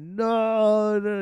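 high, loud wailing no; mock-bawling, voice cracking high; retold sobbing no. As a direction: cry it out like a kid over a broken gift. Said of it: "I'm over here, like, bawling".

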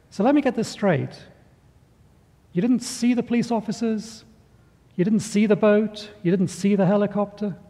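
a mildly exasperated Saint Peter spelling it out; incredulous Saint Peter. Acted.

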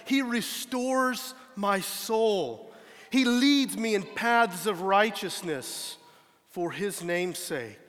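slow, measured reading of the psalm; unhurried solemn scripture reading. Acted. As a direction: read each line slowly and reverently. Reads Psalm 23 aloud.

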